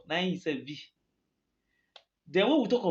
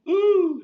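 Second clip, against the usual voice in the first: sudden high loud "ooh"; loud exclamation; 13 semitones higher, 9 dB louder than usual. Real, not acted. Exclaiming about Tinubu mid-rant.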